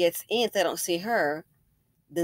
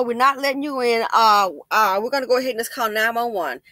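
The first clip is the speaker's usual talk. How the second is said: ordinary talk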